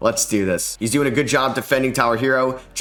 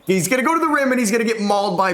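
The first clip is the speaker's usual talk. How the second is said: much higher, eager play-by-play call; excited call; 10 semitones higher, faster than usual. Real, not acted. Gamer calling Cade's drive late in the game, excited. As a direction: ride the drive to the rim.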